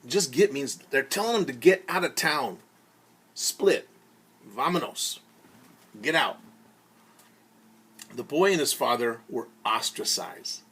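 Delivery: ordinary talk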